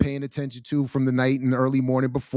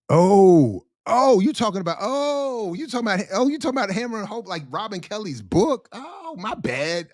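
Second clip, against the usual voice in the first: high-pitched string of dawning ohs; mock realization; 7 semitones higher than usual. Pretending to realize they meant the book, mock surprise. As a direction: act like it just clicked, pitch jumping up.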